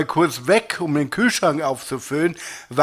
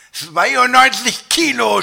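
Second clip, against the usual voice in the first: high, loud, drawn-out cry of disbelief; shouting; 9 semitones higher, 5 dB louder, slower than usual. A narrator's reading. Dieter throws the weight back at the scale, shouting. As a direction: shout it slowly in outrage. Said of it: "schrie ich".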